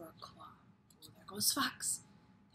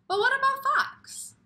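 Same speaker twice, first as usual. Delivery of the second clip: much higher, louder; excited question; 11 dB louder than usual. Acted. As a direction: spark their curiosity. Dramatic question to kids.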